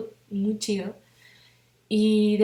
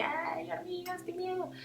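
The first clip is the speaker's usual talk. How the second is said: high, soft, slow frightened little voice; fearful ego voice; 11 semitones higher, 9 dB quieter than usual. Retold or imitated. Her frightened ego seeing the ants, in a high soft whimper. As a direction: voice the scared ego, tiny and high. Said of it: "mi miedo y mi ego así de".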